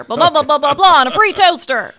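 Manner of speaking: fast mock ad-read rush; infomercial voice. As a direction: rattle it off like a TV pitchman. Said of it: "I'll try and do my infomercial voice here".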